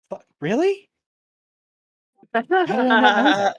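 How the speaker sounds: high, loud squeak of disbelief; mock-whispered disbelief. Player voices Kanan reacting to what he overheard, loud high disbelief. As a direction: blurt it out far too loudly for a self-whisper. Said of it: "he just whispers to himself".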